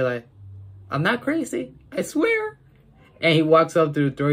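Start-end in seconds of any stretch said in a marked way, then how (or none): 0.9-2.6 very high squeaky protest. Retold or imitated. Kid voicing Jared insisting, very high. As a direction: squeak it out, desperate to be believed. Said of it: "when Jared starts to say".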